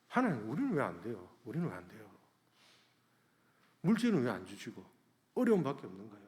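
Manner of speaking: understated, quick plea to God; tearful complaint. Retold or imitated. Pastor recalling his prayer near tears, understated tearful complaint. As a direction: lament in a subdued everyday voice. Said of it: "눈물이 날라더라고요".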